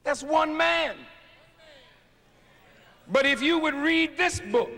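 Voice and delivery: fiery sermon shout; preaching at full force. Acted. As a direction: hammer each phrase at the crowd. Preaching peak.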